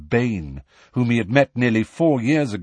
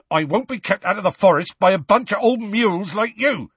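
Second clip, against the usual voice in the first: high, loud, defiant outburst; 11 semitones higher, 3 dB louder than usual. A narrator's reading. Hagrid refusing the centaurs' banishment, loud. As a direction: raise the voice and defy them. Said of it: "said Hagrid loudly".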